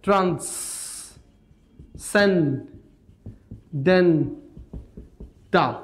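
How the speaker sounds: Transcendental very slowly, four separated syllables; broken up. Shown as a demonstration. Teacher showing how to break a word, very slow syllables. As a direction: stretch each syllable of transcendental with long pauses. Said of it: "ভেঙে পড়তে হবে".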